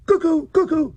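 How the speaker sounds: high, loud cuckoo call from the platform. A narrator's reading. Clock cuckoo calling out the hour, loud and high. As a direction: sing out the hour like a clock bird. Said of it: "it then shouted".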